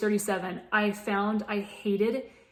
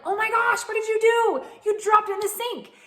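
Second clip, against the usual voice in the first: put-on high, loud, shrill snap at her child; snapping at daughter; 13 semitones higher, 5 dB louder than usual. Exasperated mom snapping at her little girl over the toothbrush, high and loud. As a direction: lose it over a dropped toothbrush. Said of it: "And I just lit off".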